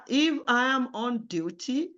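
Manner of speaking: ordinary talk, nothing marked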